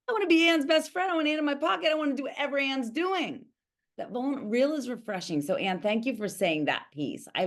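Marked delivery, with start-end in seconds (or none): 0.1-3.4 high-pitched mock fangirl gushing; gushing admirer. Coach voicing people charmed by her co-host, gushing.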